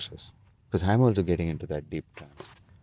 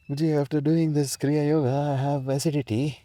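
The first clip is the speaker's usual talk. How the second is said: mocking, high whining student voice; whiny student; 7 semitones higher than usual. Mimicking a student complaining to Guruji, high whiny voice.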